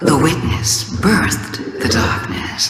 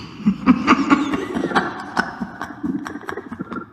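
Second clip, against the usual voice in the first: taunting chuckle; 10 semitones higher, 4 dB quieter than usual. Acted. Taunting the one who killed her, amused chuckle. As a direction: let a chuckle trail away.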